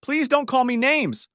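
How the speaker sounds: high, drawn-out pleading; begging. A narrator's reading. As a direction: beg in a high, slow wail. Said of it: "Linda begged".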